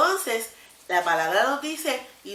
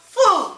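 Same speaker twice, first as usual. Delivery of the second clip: sudden fum; 10 semitones higher, 6 dB louder than usual. Acted. King throws Daniel into the den.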